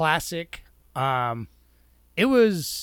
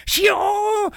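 kung fu yell; 4 dB louder than usual. Retold or imitated. Mimicking Bruce Lee's battle cry.

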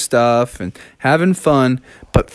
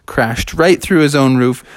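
ordinary delivery

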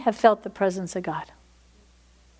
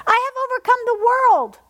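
higher, louder triumphant repeat; climactic shout; 11 semitones higher, 4 dB louder than usual. Acted. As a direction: lift it to a triumphant peak. Repeated declaration, second take.

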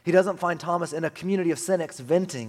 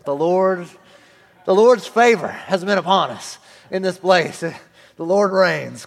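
mock preacher; 5 semitones higher, 12 dB louder, slower than usual. Sudden preacher outburst parody.

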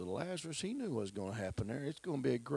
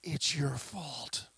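slow whispered accusation; accusing whisper; 3 semitones higher, much slower than usual. Acted. Mary's hidden meaning, whispered slowly to Jesus. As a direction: breathe the blame out slowly. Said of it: "What was she really saying".

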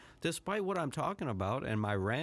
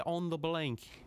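higher, mock British accent; 6 semitones higher, slower than usual. Shown as a demonstration. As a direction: say it like a Brit, lightly put on. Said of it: "sue me if that's an incorrect accent".

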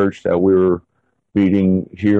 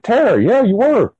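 much higher, pressing back at her; rattled insisting; 13 semitones higher than usual. Retold or imitated. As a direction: insist like you can't believe it. Husband insisting to wife.

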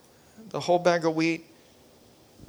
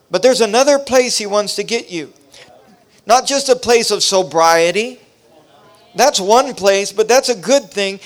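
higher, louder preacher's cadence; full-force preaching; 5 semitones higher, 7 dB louder than usual. Real, not acted. Pastor exhorting the congregation, fervent preaching. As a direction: build the sermon to a climax.

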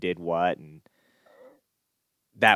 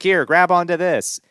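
mock helpful offer; 10 semitones higher, 8 dB louder than usual. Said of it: "Be like".

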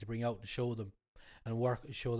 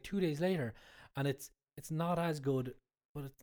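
ordinary talk

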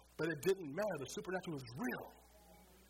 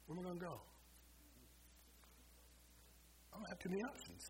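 defeated quiet question; 5 semitones higher, 9 dB quieter than usual. Retold or imitated. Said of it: "I said".

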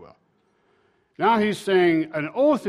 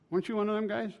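lightly voiced servant girl, higher and softer; a soft, pitched-up question, lightly acted; 6 semitones higher, 6 dB quieter, much faster than usual. Servant girl questioning Peter, lightly voiced curious asking. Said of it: "There's a servant girl comes up".

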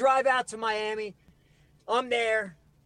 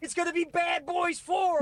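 excited outburst; 6 semitones higher than usual. Real, not acted. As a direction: blurt it out like a dream come true. Excited fantasy of movie fame.

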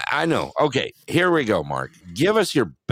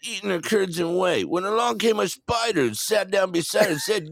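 high, swaggering stand-up nursery rhyme; pitched-up comic impression; Andrew Dice Clay; 6 semitones higher than usual. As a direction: recite it like a brash comic, rising into the punchline. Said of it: "Andrew Dice Clay".

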